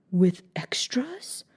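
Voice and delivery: slow, hushed and anxious; a little anxiously. A narrator's reading. Mock Turtle anxiously asking Alice about her school. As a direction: ask it under your breath, worried. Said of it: "a little anxiously".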